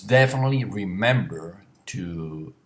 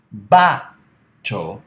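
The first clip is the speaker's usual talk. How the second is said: "bacio" with the first syllable punched loud and drawn out; stress on first syllable; 10 dB louder than usual. Shown as a demonstration. Teacher showing where bacio takes its stress, emphatic first syllable. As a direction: hit the opening "ba" of "bacio" hard. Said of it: "You put the stress in ba".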